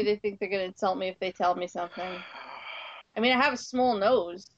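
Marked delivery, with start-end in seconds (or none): none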